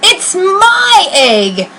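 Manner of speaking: slow, high, drawn-out demand; shouted. Acted. Maisie demanding her egg back from Horton, slow and shrill. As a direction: stretch each word out possessively. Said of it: "she shouted".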